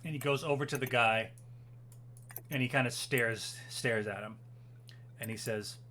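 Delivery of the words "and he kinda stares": ordinary talk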